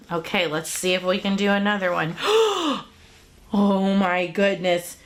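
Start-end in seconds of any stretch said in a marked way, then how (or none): 2.2-2.8 mock gasp. Mock surprise at squiggle.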